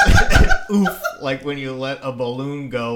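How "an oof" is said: high squeaky laughing oof; oof sound. Acted. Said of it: "letting out an oof".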